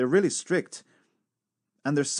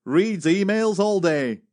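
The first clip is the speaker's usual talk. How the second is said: quoting the cadet; 6 semitones higher, 4 dB louder, slower than usual. Retold or imitated. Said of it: "And he said".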